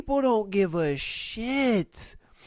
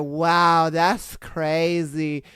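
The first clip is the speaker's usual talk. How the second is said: slow, low mock amazement; unbothered mimicry; 5 semitones lower, much slower than usual. Imitating a customer talking to a robbed cashier, blasé. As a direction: drag it out like you couldn't care less.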